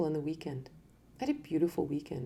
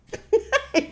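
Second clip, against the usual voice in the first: a short, high burst of laughter; 8 dB louder than usual. Real, not acted. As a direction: laugh out loud at the idea. Laughs at own example.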